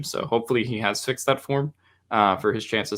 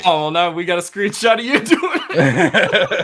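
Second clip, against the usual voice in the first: laughing; 9 semitones higher, 8 dB louder than usual. Real, not acted. Teasing about embarrassing screenshot.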